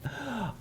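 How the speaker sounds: a short disarming laugh to soften the offer; brief easy chuckle, just kidding; light laugh to break the tension; back chuckle. Shown as a demonstration. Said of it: "The back chuckle is".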